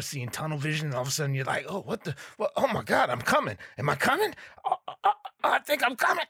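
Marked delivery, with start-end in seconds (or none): 1.7-6.2 rising higher and faster panic; mock climax. Old man in the jacuzzi thinking he's climaxing, rising frantic squeal. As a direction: squeal in confused ecstasy.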